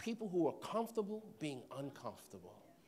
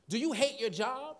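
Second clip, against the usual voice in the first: preacher's opening question; 6 semitones higher, 3 dB louder than usual. Shown as a demonstration. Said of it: "That's exactly how I would start".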